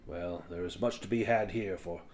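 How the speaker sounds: lightly voiced weary dwarf; gruff dwarf. Dwarf asks and advises.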